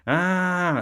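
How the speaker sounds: high, loud drawn-out "ah"; startled scream. Acted. Sleeper waking to a floating eyeball, startled scream. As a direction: cry out in alarm, long and high. Retold fright at hand in pocket.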